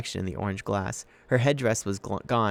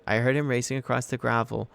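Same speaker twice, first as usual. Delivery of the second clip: usual speaking voice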